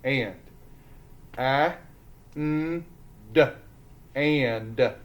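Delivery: "And" split into short a, n, d, then blended; sounding out. Shown as a demonstration. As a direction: phonics drill on "And", sound by sound. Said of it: "Roller coasters ready?".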